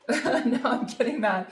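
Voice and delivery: slow, laughing through the words. Real, not acted. Tarot reader laughing at her own mock anger. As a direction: joke about her own anger, chuckling.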